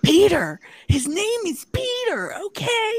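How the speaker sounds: high, loud mock-hysterical yell; acted angry outburst. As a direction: screech it back in a hammed-up quarrel. Acted reply in the retold quarrel.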